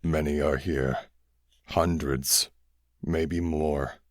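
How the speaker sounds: low, very slow weary voice; dying alien. A narrator's reading. Dying alien correcting the boys, low and slow. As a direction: drag it out, grave and tired.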